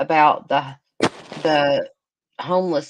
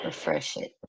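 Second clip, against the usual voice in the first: whisper; 6 semitones lower, 10 dB quieter than usual. Real, not acted. Muttering to herself at the screen.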